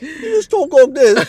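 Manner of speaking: high, loud parody British voice; mock British accent. Shown as a demonstration. Teasing the co-host's method, high mock British accent. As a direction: exaggerate a squeaky-high British lilt. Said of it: "that's my British accent".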